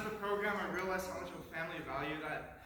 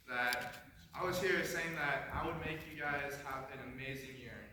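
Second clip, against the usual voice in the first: ordinary talk, nothing marked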